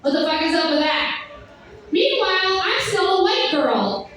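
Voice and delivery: usual speaking voice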